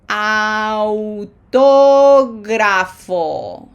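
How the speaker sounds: Autógrafo stretched very slowly, ah then u; Autógrafo with a slow glide from a to u. Shown as a demonstration. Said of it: "Say it slowly like that".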